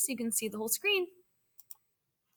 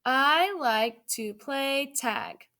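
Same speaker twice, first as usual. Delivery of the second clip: put-on slow, word by word for young readers; slow read-aloud; much slower than usual. Shown as a demonstration. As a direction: read it out slowly so kids can follow. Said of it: "Let's read it".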